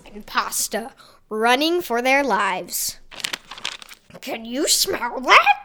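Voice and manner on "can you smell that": grotesque monster voice. Acted. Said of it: "said the zombie leader".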